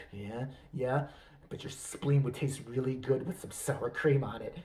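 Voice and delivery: low, softened bedroom murmur; hammed-up pillow-talk voice; Armie Hammer. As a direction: play a mock seducer, low and hushed. Seducer turns to cannibal talk.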